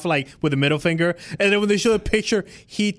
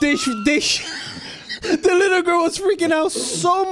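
laughing; 11 semitones higher than usual. Real, not acted. Laughing mid-story.

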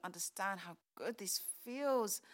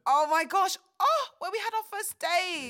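higher, loud happy exclamation; thrilled at the surprise; loud excited gush; delighted squeal; 7 semitones higher, 12 dB louder than usual. Acted. Table booked at first-date restaurant.

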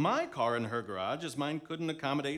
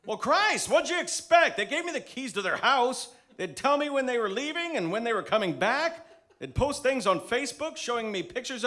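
indignant; 6 semitones higher, 5 dB louder than usual. Acted. Caught thief defends himself.

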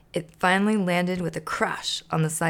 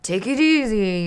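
louder grumpy yell; yelling grouch; 4 semitones higher, 6 dB louder than usual. Acted. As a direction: bark at the spinner to stop. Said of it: "yelled the trash can".

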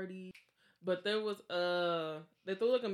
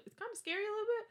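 much higher, quick squeaky fright; ending her ghost story with a playful high squeak; mock scared; faster than usual. Real, not acted. Admitting fear, mock voice.

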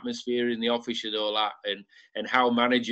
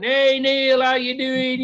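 much higher and louder, a pub crowd calling out; shouting greeting; 12 semitones higher, 5 dB louder, much slower than usual. Retold or imitated. Pub regulars greeting Neil, a retold loud shout. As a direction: call across a crowded pub to a friend. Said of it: "everybody in the club was shouting".

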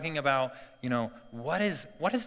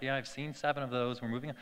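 unimpressed dad; 2 semitones lower, 8 dB quieter, faster than usual. Retold or imitated. Dad hurrying his son past a frog, quiet mock indifference. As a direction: dismiss it quietly, as if seen it all.

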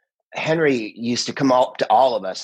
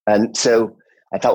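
ordinary delivery

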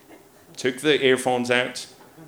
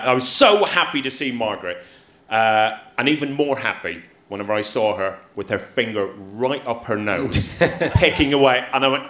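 ordinary delivery

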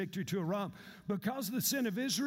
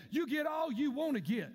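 preaching shout; 5 semitones higher than usual. Real, not acted. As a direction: hit the congregation with it full voice.

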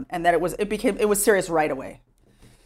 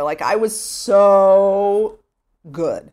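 long, drawn-out so, louder; stretched so; 7 dB louder, much slower than usual. Real, not acted. Remembering her good-girl youth, so stretched out long. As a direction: lean hard on the word so, dragging it out.

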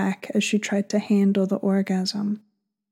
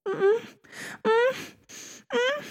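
moans climbing higher each time; rising moans; 5 dB quieter, slower than usual. Acted. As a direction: mm sounds rising in pitch. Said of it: "Her voice was vibrating".

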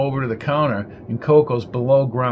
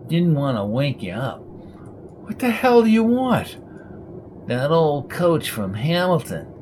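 Winky's raspy monotone. A narrator's reading. Winky reporting to Coco at night, raspy monotone. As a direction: drone it out, no lift.

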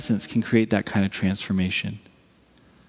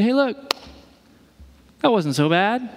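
high-pitched mock cheer; putting a ribbon on; 8 semitones higher than usual. Voicing someone tidying up suffering, falsely upbeat. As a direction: gloss over it in a lifted, chipper voice. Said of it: "put a nice ribbon on it".

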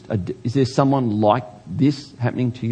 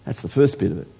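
ordinary talk

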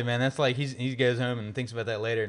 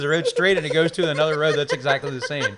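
raised voice, high and laughing; loud, amused objection; 6 semitones higher, 10 dB louder than usual. Real, not acted. Rider shouting down friend's escape plan, loud and amused. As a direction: argue it loudly through amusement. Talking over friend in joking recap.

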